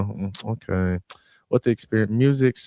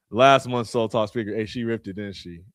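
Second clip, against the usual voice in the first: ordinary talk, nothing marked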